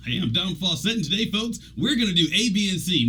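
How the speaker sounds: high, hammed-up presenter pep; mock announcer cheer for the folks; fake leader voice. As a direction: play the phony upbeat boss. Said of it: "like I'm being a fake leader".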